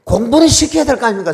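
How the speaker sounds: high, loud indignant demand; loud protest. Retold or imitated. Pastor demanding his children's schooling, loud protest. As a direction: hurl the complaint upward. Arguing with God.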